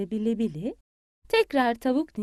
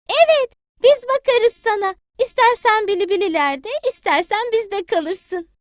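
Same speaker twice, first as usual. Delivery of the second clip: high, sweet little chick; a polite child greeting granny; 10 semitones higher, 7 dB louder than usual. Acted. Payti politely greets granny hen, high child voice.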